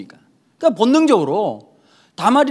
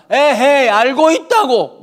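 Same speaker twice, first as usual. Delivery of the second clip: higher, louder, drawn-out Gyeongsang brush-off; exasperated Gyeongsang parent; 6 semitones higher, 6 dB louder, much slower than usual. Retold or imitated. Gyeongsang parent brushing off a nagging child, mock Gyeongsang accent. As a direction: wave the kid away in a Gyeongsang accent. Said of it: "부모님들 중에 경상도 분 계십니까".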